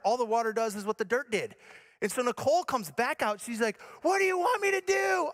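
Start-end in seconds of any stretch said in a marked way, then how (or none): 4.0-5.3 high mock shout; hammed-up shrieked question, pitched way up; panicked wife. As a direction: imitate a frantic wife demanding instructions. Acts wife in fire panic.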